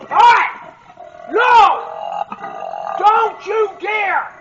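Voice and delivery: high, loud warning shouts; "Don't you dare" stretched out slowly; startled shouting. Real, not acted. Sow charges at him.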